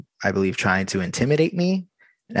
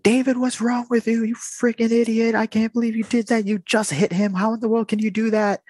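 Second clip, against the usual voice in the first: much higher, mock shrill wife scolding her husband; wife yelling; 13 semitones higher than usual. Wife scolding husband David after he punched the worker, high mock voice. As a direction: imitate the shocked wife berating him, pitched way up. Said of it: "she's like".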